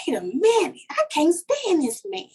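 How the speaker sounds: whispered, rising to high disbelief; shocked at herself. Retold or imitated. Woman after hugging her child's ex, whispered disbelief. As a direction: catch yourself mid-hug in disbelief. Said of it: "And then I'm like".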